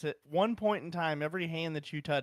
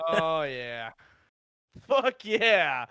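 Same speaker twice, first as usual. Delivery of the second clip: triumphant whoop; 6 dB louder, much slower than usual. Real, not acted. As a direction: celebrate like you just won. S'mores fire is going.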